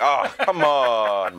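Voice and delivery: hammed-up childish complaint; mock whine.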